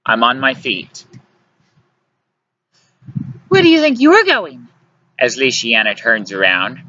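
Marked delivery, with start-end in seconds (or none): 3.5-4.7 high, loud scolding woman; indignant demand. A narrator's reading. Luciana stops him leaving the table, indignant demand.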